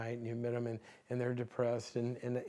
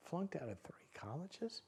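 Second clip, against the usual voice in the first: whispered, softer, rising in disbelief; stunned whisper; 4 semitones higher, 12 dB quieter than usual. Retold or imitated. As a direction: breathe it out in shock. Therapist stunned by the answer.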